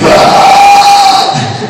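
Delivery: very high, loud shared scream; class screams. Pretend. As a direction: scream back in terror. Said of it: "all the students are like".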